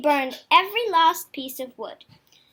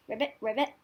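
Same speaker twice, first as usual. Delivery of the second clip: faint croak; 4 semitones lower, 7 dB quieter, much faster than usual. Acted. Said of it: "exhausted frog croak".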